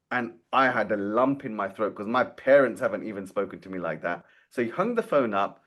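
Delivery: usual speaking voice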